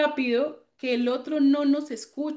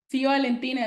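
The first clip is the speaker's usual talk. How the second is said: ordinary delivery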